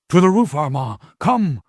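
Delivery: shouted high and loud over the fire; urgent shout. Acted. Master calling Armand to the roof, urgent shout. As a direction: yell for him to follow you up.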